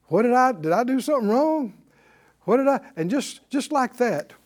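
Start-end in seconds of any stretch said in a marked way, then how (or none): 0.1-2.8 worried pleading. Retold or imitated. Retold anxious bedtime prayer.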